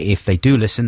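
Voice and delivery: ordinary talk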